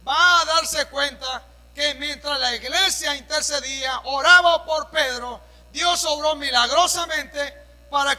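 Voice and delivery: loud, driving sermon delivery with slow emphatic stretches; full-force preaching. Acted. Pastor preaching to the congregation about Peter, loud and fervent.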